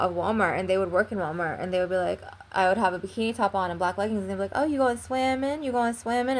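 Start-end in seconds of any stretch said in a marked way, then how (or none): 4.5-6.4 higher pitched teasing sneer; mocking taunt. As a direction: mimic a snide coworker taunting her. Mocking workers voiced.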